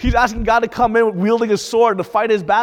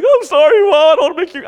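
put-on much higher and louder, pleading child's apology; child apologizing to mom; 10 semitones higher, 9 dB louder than usual. Young son to his crying mother, high pleading apology. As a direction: plead like a guilty little kid. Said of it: "And I'd be like".

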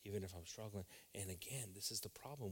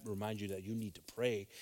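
ordinary delivery

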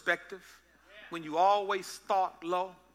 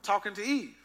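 ordinary talk, nothing marked

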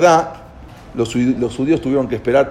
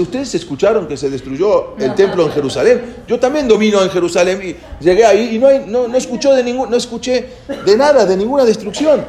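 raised, louder mock disbelief; incredulous questioning; 8 semitones higher, 3 dB louder than usual. Napoleon questions his soldiers about Jerusalem's destruction, incredulous. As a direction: play a retold commander who cannot believe the news.

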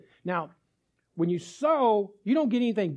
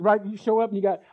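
ordinary talk

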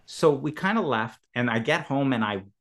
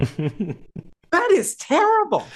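high, loud mock horror; imitating a friend's high, loud dismay; shocked; 5 dB louder, much slower than usual. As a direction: recoil at the idea, much higher. Repeated shocked quote, slow.

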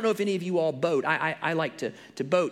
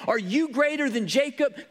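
higher, louder, plaintive ask; pleading question; 10 semitones higher, 5 dB louder than usual. Retold or imitated. Woman at the well pleading with Jesus, lightly voiced. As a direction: lift it into a plea. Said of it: "She's asking the question".

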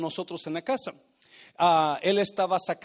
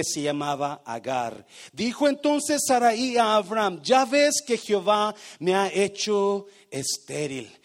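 loud, declaimed verse with stretched key words; emphatic scripture reading; 6 dB louder than usual. Real, not acted. Preacher reads Sarai's story to the church, emphatic loud declamation. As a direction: proclaim the verse to a congregation.

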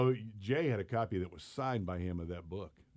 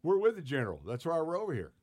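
higher, louder mock bluff; confident bluff; 9 semitones higher, 4 dB louder than usual. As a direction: sell the lie breezily. Bluffing the cook, retold.

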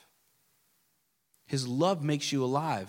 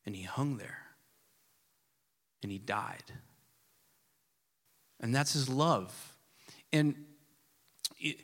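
normal talking voice

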